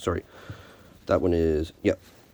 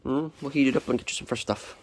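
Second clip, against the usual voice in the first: ordinary talk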